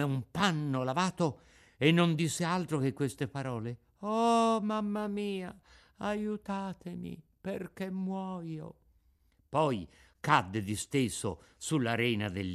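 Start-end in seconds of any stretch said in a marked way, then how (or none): 4.0-8.7 dying plea. A narrator's reading. Struck boy fainting, dying.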